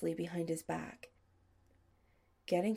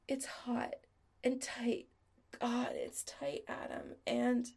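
higher, slow, half-whispered; breathless lover; 5 semitones higher than usual. Acted. Chris describing to Adam on the phone, breathless and higher. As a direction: gasp it out slowly, losing control.

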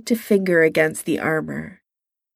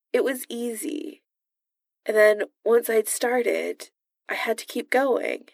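much softer, trailing confession; reluctant confession; 3 semitones higher, 5 dB quieter than usual. Acted. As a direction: admit it under his breath. Reluctant confession under the mask.

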